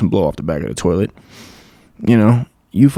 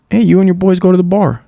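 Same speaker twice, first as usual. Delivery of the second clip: imitating Wes; 8 semitones higher than usual. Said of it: "this is the example he always gives".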